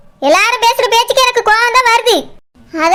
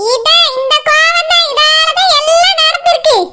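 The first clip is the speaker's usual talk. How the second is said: high, pointed village woman's accusing voice; put-on fish seller voice; 5 semitones higher, slower than usual. Fish seller accusing the owner, high and pointed. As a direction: jab at him as the nosy fish seller.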